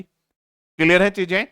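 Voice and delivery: ordinary talk, nothing marked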